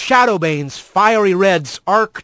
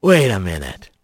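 much lower, slurred and dopey; 10 semitones lower than usual. A narrator's reading. Stoned Bobcat speaks.

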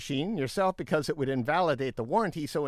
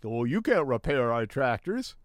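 lower-pitched mock uppity company voice; 4 semitones lower than usual. John Deere to farmers who repair tractors, mock uppity. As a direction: imitate a company getting all uppity. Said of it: "they got all uppity".

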